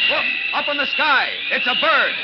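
high, loud awed cries; excited shouting. Acted. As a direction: shout up at the sky. Crowd calls out in awe.

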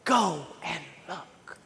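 low, very slow, quiet parental command; parent's order. Retold or imitated. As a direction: spell it out slowly and softly, like a parent to a bored kid. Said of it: "you would say to them".

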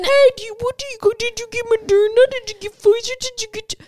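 very high, excited mock voice; nosy vaccine braggart. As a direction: pester people in a high, silly put-on voice. Imitates nosy people asking about shots.

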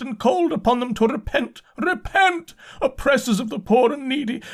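high-pitched frenzied sermon of repentance; loud raving. A narrator's reading. Insane curate confessing his sins to God, loud high raving. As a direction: rave aloud like a crazed preacher. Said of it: "began to talk aloud instead of whispering".

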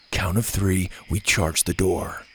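low, slow, steady plan; cool, unhurried command; calm. A narrator's reading. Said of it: "Gavin said calmly".

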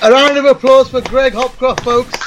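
high-pitched showman introduction; host's announcement. Real, not acted. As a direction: present the guest to the audience.

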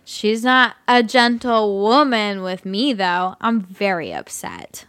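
put-on drunk voice. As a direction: play a sloppy drunk guy. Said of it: "I'll just do my drunk voice".

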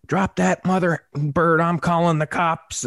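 high, slow, drawn-out threat; imitated irate holler. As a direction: mimic a neighbour calling threats across a field. Irate neighbour threatens from afar.

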